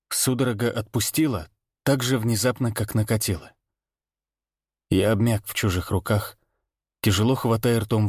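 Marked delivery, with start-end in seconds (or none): none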